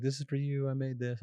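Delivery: put-on high little girl voice; sweet small child voice.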